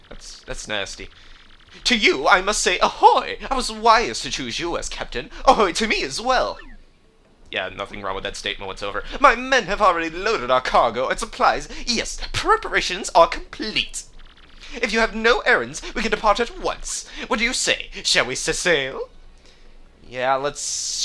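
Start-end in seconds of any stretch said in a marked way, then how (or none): 1.8-6.6 high, theatrical, swooping captain voice; hammed-up jubilant captain; pompous ship's captain. 9.2-19.1 high, theatrical, swooping captain voice; hammed-up jubilant captain; pompous ship's captain.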